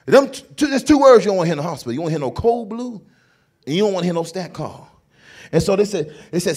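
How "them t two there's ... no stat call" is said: ordinary delivery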